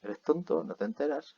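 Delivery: lightly mocking question. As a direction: ask it as a joking jab, without "es que". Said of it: "en español también tenemos este es que".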